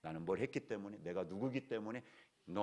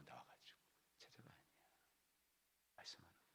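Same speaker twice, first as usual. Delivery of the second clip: whispered; 3 semitones lower, 28 dB quieter, faster than usual. Real, not acted. Preacher drops to whisper.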